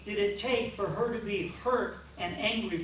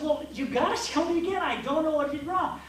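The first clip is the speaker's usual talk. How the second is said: higher and louder, exasperated insistence; frustrated plea; 7 semitones higher, 5 dB louder than usual. Retold or imitated. Husband begging wife to explain again, exasperated and high. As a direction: demand an answer, at wits' end. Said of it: "I would just be frustrated".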